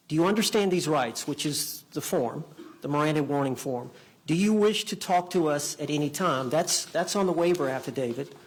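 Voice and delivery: usual speaking voice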